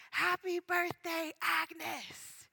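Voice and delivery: mock-shout a surprise greeting, stretching each word; imitated shout. Tony greets Agnes at her surprise party, imitated shouting. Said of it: "Tony shouting".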